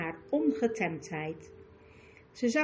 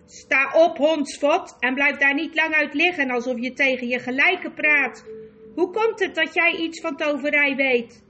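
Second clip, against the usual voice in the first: louder, higher scornful queen; contemptuous; 3 semitones higher, 7 dB louder than usual. A narrator's reading. Witch queen sneering at the groveling magician, contemptuous. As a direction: look down on him with disdain. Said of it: "zei ze minachtend".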